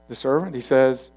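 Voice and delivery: ordinary talk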